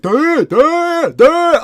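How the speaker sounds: very high, loud, droning duh; mentally ill stranger's duh. Retold or imitated. Imitating the stranger stepping out of the woods, high loud drone. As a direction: mimic the stranger's wordless drone. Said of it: "he was trying to speak".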